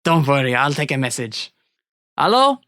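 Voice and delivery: mock-courteous French-accented captor. Hunter answering a tied-up captive's phone, French accent. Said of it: "that sounded like a genuine French accent".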